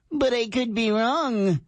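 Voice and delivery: high, drawn-out mock concession; snarky commenter voice. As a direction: stretch it out high and snide. Snarky reading of YouTuber comment.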